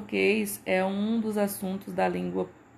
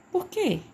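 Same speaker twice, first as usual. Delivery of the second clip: high-pitched question, "quê" hit hard; stress on QUÊ; 12 semitones higher, 4 dB louder than usual. Shown as a demonstration. Teacher reading the comic's question to students, stressed QUÊ. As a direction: punch "quê" as the strong syllable. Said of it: "o que ele foi pronunciado".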